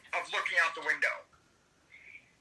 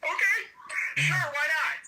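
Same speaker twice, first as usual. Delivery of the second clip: high-pitched, uneasy going-along; reenacted jittery yes; nervous agreement; 8 semitones higher than usual. Acting out his nervous agreement to fly.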